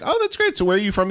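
overly cheery passenger making small talk; a sing-song polite question in the Uber; mock chirpy small talk.

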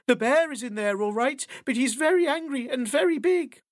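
eager owl volunteering. Acted. Owl reports on the bear.

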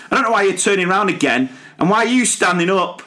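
mock exasperated teacher; exasperated telling-off. Teacher calling out a child turned around on the carpet, exasperated.

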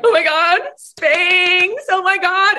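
high, gushing mock excitement; excited freak-out. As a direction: imitate a founder freaking out over her idea. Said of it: "It's her going".

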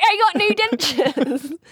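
slow toothless Bristolian, half laughing. Retold or imitated. Retelling the uncle's line, slow laughing Bristolian. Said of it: "gone Bristolian".

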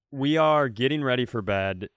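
ordinary talk, nothing marked

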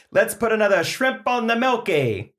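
mash up Aussie and Boston tough guy; Australian-Boston accent mix. Pretend. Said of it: "two accents in one".

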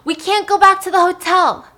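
loud high yell after her; screaming. Retold or imitated. Stan shouting after Molly as she leaves, desperate scream. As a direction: scream her name as she walks away. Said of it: "And he's screaming".

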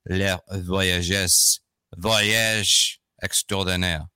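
very slow, clumsy French accent; cartoonish French accent. Real, not acted. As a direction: labour slowly through a poor French accent. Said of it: "almost cartoonish when I say it with my poor French accent".

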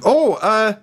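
high, flustered stammering greeting; flustered dad. Acted. Dad flustered greeting a gold-clad king. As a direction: stammer up high, caught off guard.

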